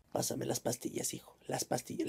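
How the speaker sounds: low, soft, weak plea; feeble pleading. Acted. Mother asking her son for the pills in a faint voice. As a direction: sound frail and dying.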